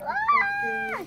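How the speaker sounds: sudden high shriek; high scream. Acted. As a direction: yelp in pain. Bunny bitten, screams.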